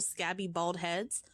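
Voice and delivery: plain reading voice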